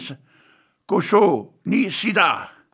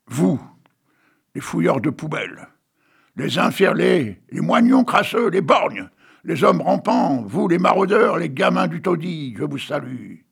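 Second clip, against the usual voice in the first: declaimed, rising and louder on each address; poem recital; 3 semitones higher, 4 dB louder than usual. Acted. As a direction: hail the outcasts one by one. Reads a poem aloud. (récitation de poème, déclamée avec emphase)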